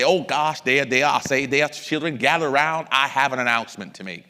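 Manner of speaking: loud, blustery cartoon rooster; Foghorn Leghorn. Dad calling his children to gather in the kitchen, blustery cartoon imitation. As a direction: boom it out like a Southern cartoon chicken. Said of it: "This is my foghorn leghorn chicken imitation".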